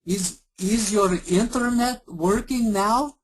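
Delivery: slow, high put-on Eastern European accent; mock agent repeating it slowly in an Eastern European accent; hammed-up Eastern European accent, slow; bad Eastern European accent, slow. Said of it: "really bad Indian and Eastern European accent".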